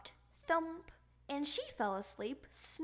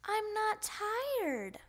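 put-on whiny child; 9 semitones higher, slower than usual. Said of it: "cried Froggy".

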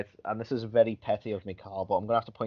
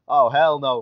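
mock sassy; 4 semitones higher, 11 dB louder, slower than usual. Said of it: "they suddenly turned very street".

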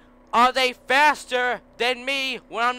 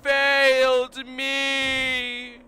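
slow loud reproach; 4 semitones higher, 7 dB louder, much slower than usual. Real, not acted. Blaming the game mid race.

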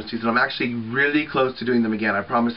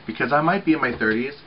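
ordinary delivery

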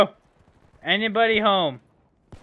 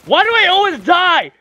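loud, high exasperated shout; real frustrated outburst; frustrated yell; 10 semitones higher, 9 dB louder than usual. Real, not acted. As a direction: cry out at the unfairness of it. Frustrated after dying again.

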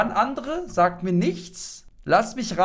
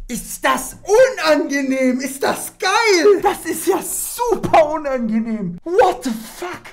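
high, loud thrilled cringing; giddy cringe; 10 semitones higher, 8 dB louder than usual. Real, not acted. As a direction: squeal at how awkward it is. Streamer reacts to awkward date. (hohes, lautes, begeistertes Fremdschämen)

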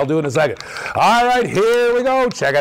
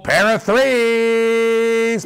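drawn-out announcement; 5 semitones higher, much slower than usual. Real, not acted. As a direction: stretch "pair of threes" out very slowly and higher. Announcing the dice result live.

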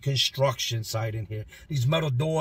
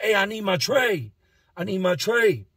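higher, loud demanding yell; 7 semitones higher, 4 dB louder than usual. Retold or imitated. Inmate yelling at the guards behind tinted glass. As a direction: shout the demand at the booth. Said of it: "So I'm yelling out".

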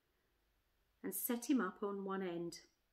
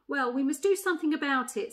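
higher, louder elephant taking charge; decisive she-elephant; 4 semitones higher, 8 dB louder than usual. Acted. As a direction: address the animals as their chairwoman.

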